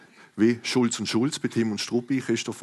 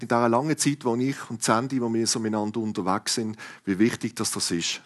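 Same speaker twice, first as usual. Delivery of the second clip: normal talking voice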